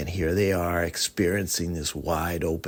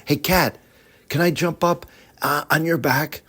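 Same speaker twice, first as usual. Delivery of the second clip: put-on higher, bright young girl reading; girl's voice; 7 semitones higher than usual. Evangeline pointing the stars out to the cat, eager girl voice.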